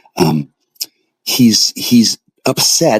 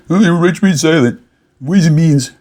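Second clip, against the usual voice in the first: mocking quote; 7 semitones higher than usual. Mocking the listener's words.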